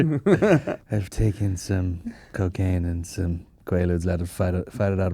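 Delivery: slow, low, soft stoned parody; slow right down as if sedated; mock drugged-up voice. Co-host playing the actor on gear, mock drugged-up voice. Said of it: "he's on gear".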